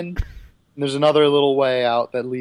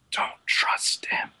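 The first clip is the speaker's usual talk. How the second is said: put-on weak, whispered old man's plea; frail whisper; 7 dB quieter, slower than usual. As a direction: barely breathe it out, frail and dying. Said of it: "you hear a frail voice".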